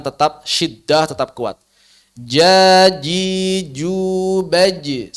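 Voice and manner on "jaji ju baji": Jaji ju baji, very slow, j from mid tongue; correct jim drill. Shown as a demonstration. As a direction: model Jaji ju baji slowly for the class to repeat. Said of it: "kita ucapkan jim di tengah lidah".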